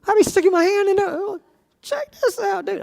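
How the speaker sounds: put-on high, excited kid voice at the sea wall; amazed kid. Kid in Sunday school marveling at the water wall, high and excited. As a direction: squeal with wonder.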